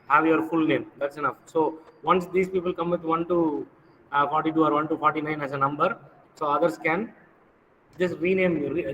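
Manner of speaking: normal talking voice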